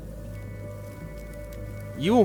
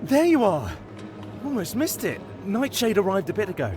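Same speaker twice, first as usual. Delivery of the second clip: eager, higher-pitched rush; excited; 6 semitones higher, faster than usual. Acted. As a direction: greet them excitedly, quick at the end. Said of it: "You seem excited".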